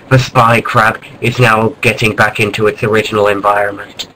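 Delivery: ordinary talk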